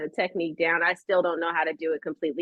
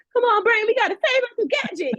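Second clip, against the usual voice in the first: put-on much higher, louder excited kid voice; childhood Penny play; 4 dB louder than usual. Child playing Penny, calling to her brain. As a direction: play the eager girl detective. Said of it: "I would, like, push the buttons".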